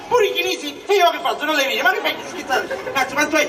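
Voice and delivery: usual speaking voice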